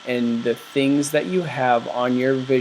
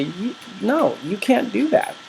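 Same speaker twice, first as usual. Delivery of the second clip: higher, whiny naysaying brain; doubting inner voice; 7 semitones higher than usual. Retold or imitated. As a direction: play the inner critic saying no. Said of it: "your brain is gonna kick against it and say".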